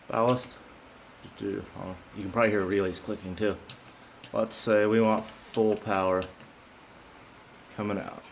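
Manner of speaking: ordinary talk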